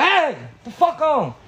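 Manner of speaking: mock yell. Storyteller chasing a stranger off from the truck, mock yell.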